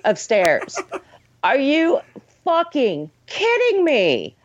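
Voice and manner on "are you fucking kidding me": drawn-out, higher disbelief; can't believe how slow it is; exasperated are-you-kidding-me; incredulous. Real, not acted. Ranting about slow stair scene.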